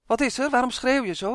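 calling out. A narrator's reading. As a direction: call out to the boy from the kitchen. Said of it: "riep zijn moeder vanuit de keuken".

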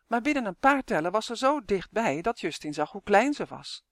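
ordinary delivery

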